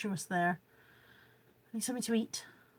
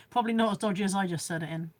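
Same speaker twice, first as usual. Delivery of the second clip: ordinary talk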